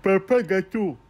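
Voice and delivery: lightly voiced sugar daddy; doting dad voice. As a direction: coo it like an indulgent father. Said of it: "je le voyais comme ça".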